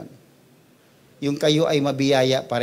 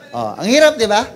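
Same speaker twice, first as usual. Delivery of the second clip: exasperated; 8 semitones higher, 11 dB louder than usual. Acted. Exasperated punchline to congregation.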